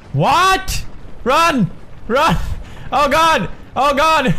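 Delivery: high, startled yelps; panicked yelling. Real, not acted. Gamer suddenly chased and looking for escape, panicked yelling. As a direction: yell in a sudden panic to flee.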